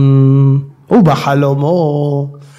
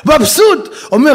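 ordinary delivery

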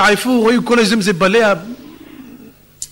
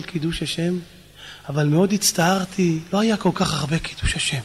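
soft, grave confession; hushed storytelling; subdued recounting; 3 semitones lower, 6 dB quieter than usual. Acted. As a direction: let the voice sink softly as the memory unfolds. Son recounts father's death by fire.

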